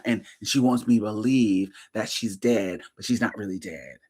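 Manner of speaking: quiet fast murmur, lightly mocking; hushed conspiratorial mock of a friend; imitating Vanessa. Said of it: "This is how she talk".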